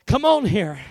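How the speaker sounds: usual speaking voice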